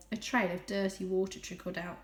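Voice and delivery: usual speaking voice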